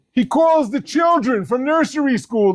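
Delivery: usual speaking voice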